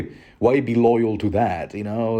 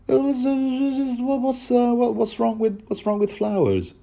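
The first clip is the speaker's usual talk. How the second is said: put-on pitched-up puzzled young woman; Ygritte's voice; 13 semitones higher than usual. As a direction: play her as wide-eyed and baffled.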